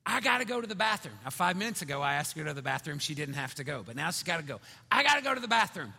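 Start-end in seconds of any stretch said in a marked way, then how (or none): 0.0-1.2 put-on fast, higher, louder kid voice; urgent child plea. Daughter telling dad she needs the bathroom, fast urgent mock whine. 4.9-5.9 rushed, high, loud imitation of a kid; urgent child plea. Daughter pleading with dad for the bathroom, fast high loud plea.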